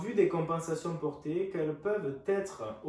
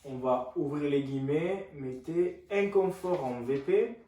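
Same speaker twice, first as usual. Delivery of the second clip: dictating; 2 semitones higher, 7 dB louder than usual. Shown as a demonstration. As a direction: spell it out for note-taking. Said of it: "je vous laisse noter".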